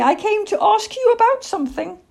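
high, loud little-owl voice; earnest owlet owning up; young owl Plop, candid. Acted. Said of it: "Plop said truthfully".

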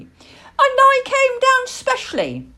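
high, loud, drawn-out complaint; slow, squeaky indignation; cross owlet. A narrator's reading. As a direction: protest like an affronted small child. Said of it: "he said crossly".

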